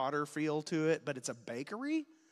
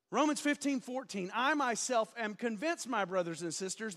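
higher-pitched, projected preacher's scripture reading; 5 semitones higher than usual. Real, not acted. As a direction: proclaim the verse like a preacher. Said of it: "stop using preacher voice".

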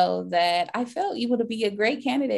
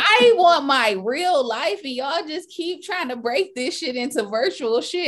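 high, loud, laughing exasperation; laughing rant, higher and louder; exasperated; 8 semitones higher, 5 dB louder than usual. Real, not acted. Laughing rant about virtual life.

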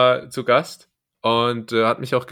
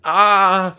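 pained gasp; 7 semitones higher, 8 dB louder than usual. Real, not acted. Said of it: "meine Nase macht weh".

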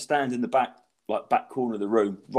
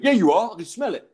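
high, mock accusing bloke; retold aggro; 7 semitones higher than usual. Bloke accusing comic of smoking, aggro. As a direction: imitate a bloke insisting he's caught you out. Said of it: "Like, quite aggro".

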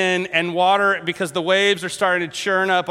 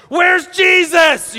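high, loud, drawn-out shout; acted panicked holler, slow and high; yelling; 10 semitones higher, 7 dB louder, slower than usual. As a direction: yell it over a windstorm. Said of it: "So they're yelling".

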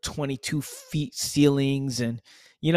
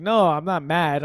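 mock loud protest; 3 semitones higher, 7 dB louder than usual. Family explaining loud talk.

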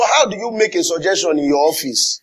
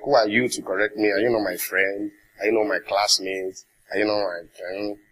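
low, softer imitation of grumblers; mock grumbling; 6 semitones lower, 5 dB quieter than usual. People who cannot receive correction talking back, mock grumbling. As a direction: grumble it under your voice, imitating objectors. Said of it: "people that grumble at correction".

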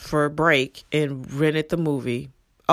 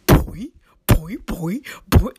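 louder springy boing noises; cartoon boing sound; 2 semitones higher, 4 dB louder, slower than usual. Retelling the film's sound effect as cartoon boings. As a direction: bounce each boing like a cartoon. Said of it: "it's all like".

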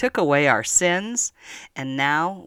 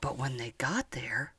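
hushed; 11 dB quieter than usual. Acted. Suspense in story.

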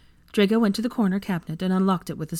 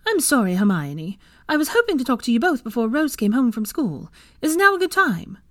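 high, climbing apologetic mother; higher-pitched polite request, rising into the question; apologetic Molly; 7 semitones higher, 5 dB louder than usual. Acted. Mother-in-law asking to talk over the Floo, apologetic.

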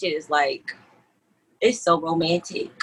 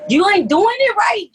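mocking buffet worker; 12 semitones higher, 5 dB louder than usual. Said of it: "it's just like".

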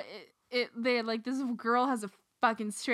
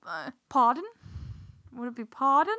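"pardon" tried in a put-on British accent. Shown as a demonstration. Searching for British slang to say, tentative British accent. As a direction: test out a British accent, questioning. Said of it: "what would I say with a British accent".

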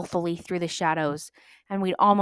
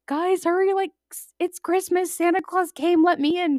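put-on high, louder childlike excitement; excited brother; 12 semitones higher, 5 dB louder than usual. Brother at the window on Christmas morning, high excited pleading. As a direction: beg to be let in, thrilled. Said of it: "he was like".

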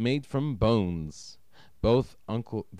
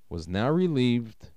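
ordinary delivery, nothing marked

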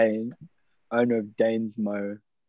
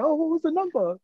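mock eager self. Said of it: "I'm like".

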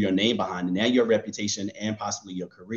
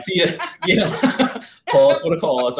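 laughing; 7 semitones higher, 7 dB louder than usual. Real, not acted. Laughing self-address.

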